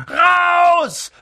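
high, loud bellowed order; roaring. A narrator's reading. Uncle orders the boys out, roaring. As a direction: explode in one furious shout. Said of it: "brüllte Onkel Vernon".

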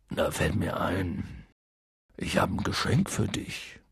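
low, soft and slow; hushed, low mumbling giant; subdued after crying. A narrator's reading. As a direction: offer it quietly, tears just over. Hagrid calming down after crying, offers gift.

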